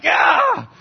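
sudden high shrieking cry; startled donkey yelps at Balaam; donkey's high yelp. Retold or imitated. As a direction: let out a high loud yelp. Donkey cries out.

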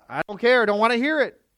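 loud, harsh scolding yell; angry parent. Acted. Parent shouting down a child's side of the story. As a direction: bark at the child, won't hear it.

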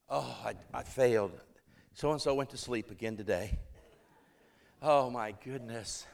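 low, dejected muttering to himself; insecure self-reproach. Retold or imitated. Young preacher lamenting a sleeper in church, low and dejected. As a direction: replay a sinking sense of failure, pitched low. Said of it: "flowing out of my own insecurity".

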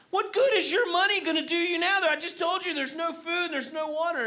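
high, loud frantic outburst; panicked exasperation. Retold or imitated. Panicking castaway yelling at the calm man, high and loud. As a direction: shout it up high in disbelief. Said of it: "the panic man looks at him".

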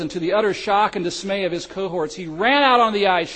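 ordinary talk

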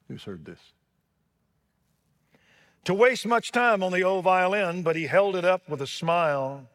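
booming, rhythmic recitation of a beloved poem; dramatic poem recital. Acted. Preacher recites the violin poem to his congregation, dramatic and soaring. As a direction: declaim it like a revival preacher.

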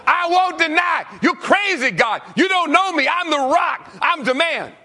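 very high, loud defiant yell; defiant shout. Acted. Peter shouting defiantly at God.

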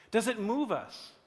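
normal talking voice

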